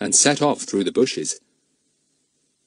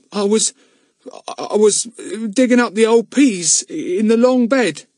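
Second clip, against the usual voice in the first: stammering; 12 semitones higher, 5 dB louder than usual. A narrator's reading. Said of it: "stammered Luke".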